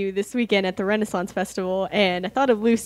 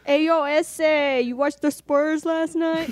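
slow, mock San Antonio street accent; 4 semitones higher, slower than usual. Mimicking a local asking about Spurs, slow San Antonio accent. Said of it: "That's basically what it sounds like".

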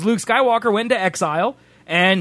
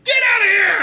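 high, louder shooing yell; mock shout; 14 semitones higher, 4 dB louder than usual. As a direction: bark at the porgs to clear off. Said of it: "He's like".

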